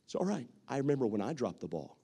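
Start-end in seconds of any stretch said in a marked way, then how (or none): 0.0-0.5 reassuring comfort. Retold or imitated. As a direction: comfort someone who dropped the ball. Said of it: "what do we do".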